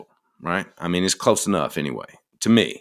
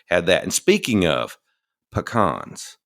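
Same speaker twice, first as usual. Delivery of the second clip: usual speaking voice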